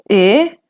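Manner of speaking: rising-tone "e". Shown as a demonstration. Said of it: "el tono ascendente".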